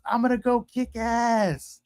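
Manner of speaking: high, drawn-out mock yell; imitated parting holler. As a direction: call it out while leaving, stretching the words. Passenger's parting yell, retold.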